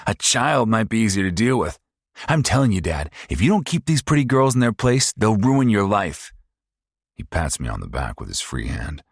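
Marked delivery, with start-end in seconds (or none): 0.1-6.3 high, loud, fast mocking sneer; sneering abusive son. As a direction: taunt her like a cruel bully. Paul voiced again.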